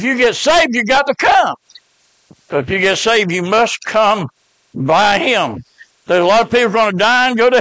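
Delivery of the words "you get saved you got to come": sudden high, loud shout of the point; preaching shout. Real, not acted. Preacher shouting to his congregation about salvation, full shout. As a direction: cry it out to the pews.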